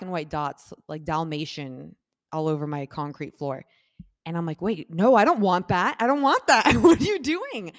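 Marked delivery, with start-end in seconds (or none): 4.6-7.7 loud protest climbing higher with each phrase; retold outraged yelp, building; alarmed protest. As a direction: escalate to a high, loud, comic panic. Retold alarmed protest about the floor.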